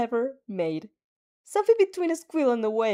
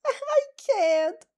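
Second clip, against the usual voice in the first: much higher, flustered and embarrassed; flustered squeak; 13 semitones higher, 3 dB louder than usual. Acted. Embarrassed friend refuses, flustered.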